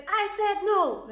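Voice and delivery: high, forceful parental outburst; angry parent. Acted. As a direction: put your foot down as an exasperated parent. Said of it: "you grab your child's arms and you said".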